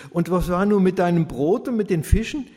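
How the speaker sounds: put-on impatient girl pressing her friend. A narrator's reading. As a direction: demand the rest of the story. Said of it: "Lea wurde ungeduldig".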